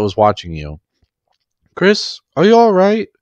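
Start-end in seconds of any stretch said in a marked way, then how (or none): 1.8-3.1 high, slow anxious question; worried Charlotte. Acted. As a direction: ask in alarm, much higher and louder. Charlotte anxiously checks on Chris.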